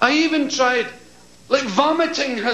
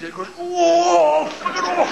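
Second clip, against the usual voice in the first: high, drawn-out whoa; slow, high hammed-up moan of release; mock climax cry; 7 semitones higher, slower than usual. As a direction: stretch the cry out in mock ecstasy.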